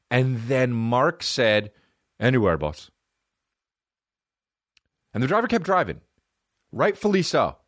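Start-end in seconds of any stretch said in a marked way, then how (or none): 2.2-2.9 low imitated Irish accent; unclear, low Irish accent. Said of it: "he's Irish as shit".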